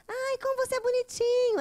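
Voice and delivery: put-on cooing at a child. Adult cooing at a toddler, high and sweet. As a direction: gush over a cute kid. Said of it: "vai falar".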